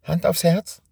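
put-on high, timid child's voice; little girl. Scared girl asking Benjamin to swear, high and timid. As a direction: ask for his promise shyly. Frightened girl asks for a promise.

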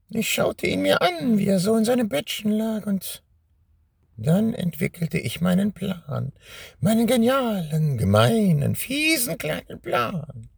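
high, gloating witch voice. Acted. Witch reveals her plan. (hohe, überhebliche Hexenstimme)

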